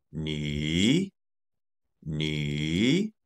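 "ni" in a low dipping third tone. Shown as a demonstration. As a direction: dip "ni" low, then let it rise. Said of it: "That is third tone".

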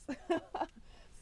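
high, bright burst of laughter; short giggle. Real, not acted. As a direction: laugh lightly at your own remark. Laughs after stating the obvious.

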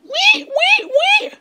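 tiny high squeak like a puppy. A narrator's reading. Jealous puppy Gottfried squeaking at his owners, very high squeak. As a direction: squeak it out like a jealous little dog. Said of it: "Gottfried begann zu quieken".

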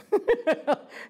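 high laugh. Real, not acted. Laughs at own joke.

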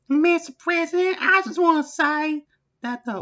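high, loud put-on aide's voice; aide Barbara. Aide Barbara reporting to the President, high put-on voice. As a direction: play the frantic aide reporting in.

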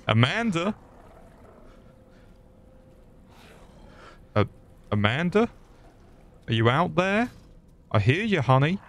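high questioning call. Pretend. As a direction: call playfully for someone out of sight. Calling out to Amanda in game.

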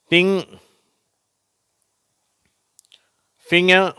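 loud, high-pitched, one word at a time; model word reading. Shown as a demonstration. Teacher reading words for pupils to repeat, loud model reading. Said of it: "நான் வாசிக்கிறேன், நீங்களும் திருப்பி சொல்லுங்க".

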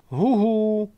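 high hooting call into the woods; ptačí volání. A narrator's reading. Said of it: "volá gajdošík v houští". (vysoké houkavé volání huhu)

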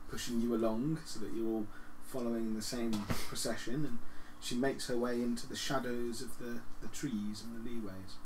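usual speaking voice

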